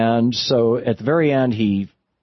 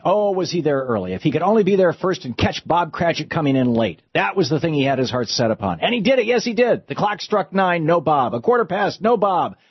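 lively read-aloud narration, somewhat higher; story reading; 4 semitones higher than usual. A narrator's reading. Host reading the Christmas Carol ending to listeners, animated storytelling.

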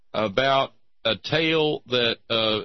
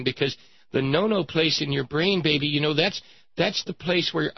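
hammed-up, higher-pitched worried parent; consoling parent; 5 semitones higher than usual. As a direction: fuss over the child with mock concern. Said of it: "they went to her and said".

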